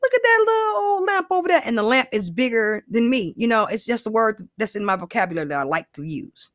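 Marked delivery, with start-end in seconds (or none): 0.0-1.6 much higher, fast, sing-song gushing voice; mock cute gush. Mocking her own habit of saying little, high, fast gushing. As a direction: gush over a tiny lamp in a high, fast put-on voice. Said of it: "I'm like".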